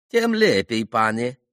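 high, loud hammed-up Polish banker; mock Polish banker. A narrator's reading. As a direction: ham up the pompous Polish dealer. Banker voice in story. (пародия на польского банкира, высоко и громко)

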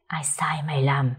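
low eerie whisper; ghostly whisper. A narrator's reading. Ghost whispers an interrogation to the servant. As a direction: breathe it like a spirit.

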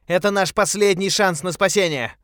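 high, loud and urgent; urgent shout. A narrator's reading. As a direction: yell it as a last hope. Calling out on the raft. (громкий отчаянный крик)

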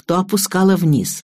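plain reading voice

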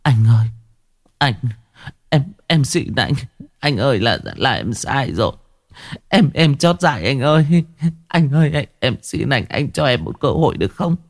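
wife begging her husband for mercy, tearful; tearful pleading. A narrator's reading. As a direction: beg on your knees, voice catching. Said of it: "Hằng Ma quỳ xuống mếu máo".

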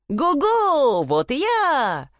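high, long-drawn halloo; calling out. A narrator's reading. Simpleton catches up with his brothers, calling out. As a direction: sing it out across the distance. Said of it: "опять закричал Ганс Чурбан".

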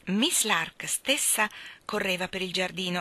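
plain reading voice

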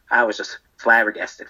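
usual speaking voice

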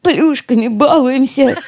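slow, laughing through the words; holding back laughter. Acted. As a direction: barely keep from laughing. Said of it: "еле совладала с собой".

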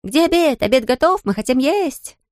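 put-on high-pitched children's outcry, not full volume; mild, high mock shouting; children clamouring. A narrator's reading. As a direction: voice hungry children calling out eagerly. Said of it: "крича один громче другого".

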